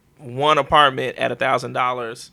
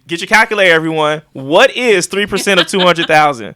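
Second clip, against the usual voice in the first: loud, raised-pitch pretend quiz to a class; mock quizmaster; 4 semitones higher, 9 dB louder than usual. Host jokingly quizzing listeners on percentages, mock quizmaster. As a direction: call it out high and loud like a playful teacher.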